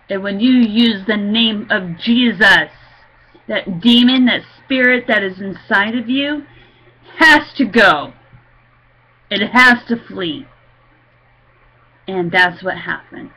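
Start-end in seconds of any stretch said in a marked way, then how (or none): 0.1-10.5 loud, emphatic proclamation; forceful preaching. Real, not acted. Believer telling listeners the demon must flee, forceful preaching. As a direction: drive each phrase home with raised voice.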